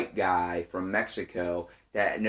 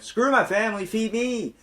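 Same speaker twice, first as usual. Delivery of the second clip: mock eager shout; 11 semitones higher, 4 dB louder than usual. Joking reply to housekeeper's offer.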